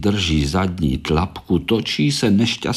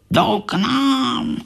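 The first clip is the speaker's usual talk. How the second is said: much higher, slow startled gasp; frightened; 14 semitones higher, much slower than usual. Acted. Korálek spotting the visitors, slow high fright. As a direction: say it in a scared little voice. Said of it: "lekl se Korálek".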